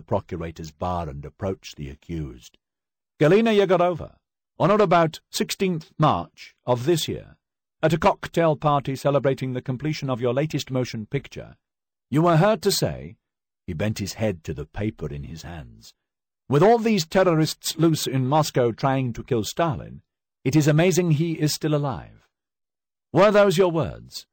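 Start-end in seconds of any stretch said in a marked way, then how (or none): 3.2-13.2 loud, high, formal reading of the charges; stern prosecutor. Acted. Prosecutor reading charges to the accused, loud and formal. 16.5-24.3 loud, high, formal reading of the charges; stern prosecutor. Acted. Prosecutor reading charges to the accused, loud and formal.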